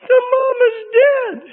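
put-on high, slow, tearful little girl's wail; imitating a crying child. Daughter in the cinema crying over the mother fox, high tearful wail. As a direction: sob it out like a small child whose cartoon mother fox just died. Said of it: "She goes".

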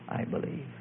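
very soft, breath-only prayer; imitating a whisper. Preacher leading a girl in prayer, whispered. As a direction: whisper it as a prompt. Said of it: "I began to whisper in her ear".

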